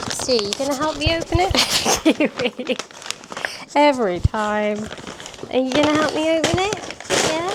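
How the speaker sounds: playful dog talk; pet voice. Real, not acted. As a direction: coax the puppy to rip it open. Coaxing the dog to help.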